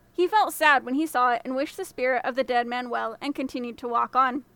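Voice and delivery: ordinary delivery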